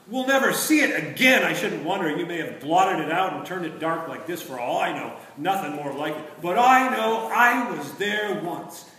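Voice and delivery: stubborn marsh-wiggle swelling to a stand; defiant Puddleglum. A narrator's reading. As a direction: fight the spell with every breath. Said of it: "talking like a man who doesn't have enough air".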